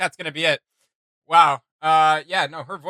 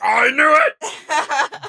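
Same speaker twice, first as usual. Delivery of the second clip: much higher, loud, slow shout breaking into a laugh; triumphant shout; 12 semitones higher, 10 dB louder, much slower than usual. Pretend. Streamer imagining finding the instrument on Google, laughing shout. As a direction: crow it like you finally found out. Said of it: "I'll be like".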